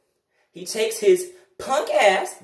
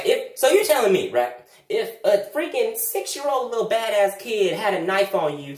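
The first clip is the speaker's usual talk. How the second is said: ordinary delivery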